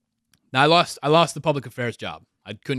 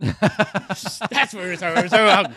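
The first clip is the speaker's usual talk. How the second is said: laughing; 5 semitones higher, 5 dB louder than usual. Real, not acted. Laughs at losing the topic.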